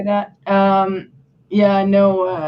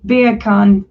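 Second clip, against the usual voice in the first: English accent; 6 dB louder than usual. Shown as a demonstration. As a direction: say Beer can like an Englishman. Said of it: "when you say beer can with an English accent".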